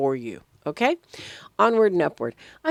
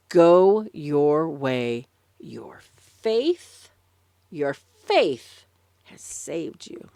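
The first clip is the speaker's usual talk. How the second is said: very slow, long pauses, weight on FAITH; slow, emphatic pronouncement; 2 semitones lower, much slower than usual. Retold or imitated. Jesus tells the healed blind man his faith saved him, slow and solemn. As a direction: draw out each word, lift on "faith". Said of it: "No, he said".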